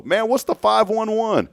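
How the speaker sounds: high, loud playful slang riff; slang greeting voice. Retold or imitated. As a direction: toss it off like a catchphrase. Riffing the slang phrase.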